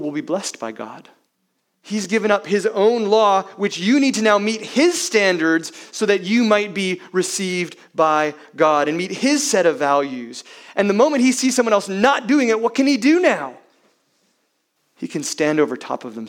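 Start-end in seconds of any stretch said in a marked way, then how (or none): 1.8-13.6 higher, louder, driving preacher's cry; preaching at full force. Real, not acted. Pastor to congregation, forceful rising preaching. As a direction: press the point hard and loud.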